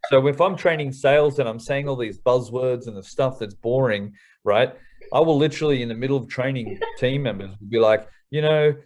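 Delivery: usual speaking voice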